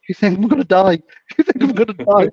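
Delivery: high-pitched, amused imitation of alarm; mock panic. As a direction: imitate a dealer protesting, half laughing. Acts panicked stabbed dealer, amused.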